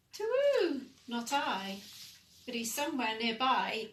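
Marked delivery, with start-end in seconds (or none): 0.1-1.8 hooting "Too" very high and loud, owl-like. Acted. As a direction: hoot like an owl, then answer. Owl answers the child.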